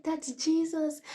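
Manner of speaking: very high, loud cry; retold scream. Dreamer screaming at staff behind glass doors, very high loud cry. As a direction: cry out the name. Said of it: "And I was screaming".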